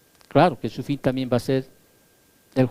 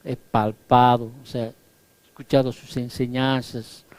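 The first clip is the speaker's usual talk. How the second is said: apostle John; 6 semitones lower, 6 dB quieter than usual. Retold or imitated. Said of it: "Juan les habla".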